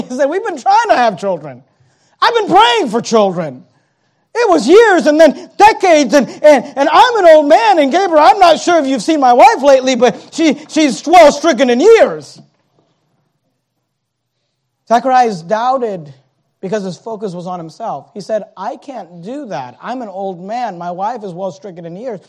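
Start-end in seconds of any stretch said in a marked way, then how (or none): 0.3-12.4 higher, louder, protesting excuses; mock-exasperated old man pleading. Zacharias complaining to Gabriel. 18.6-22.2 self-pitying mutter. Acted. Zacharias's excuses, said softly.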